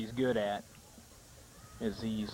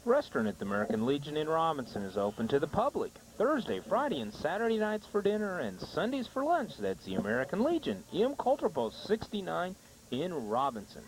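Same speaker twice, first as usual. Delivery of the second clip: sing-song commercial read with big pitch swings; sponsor ad read; 4 semitones higher than usual. Acted. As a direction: pitch it like a local radio spot. Reads restaurant ad.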